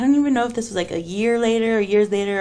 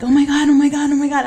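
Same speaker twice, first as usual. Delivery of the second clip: higher, louder panicked oh my Gods; retold freak-out; 7 semitones higher, 5 dB louder, faster than usual. Retelling seeing a ghost boy to friends, panicked higher and louder.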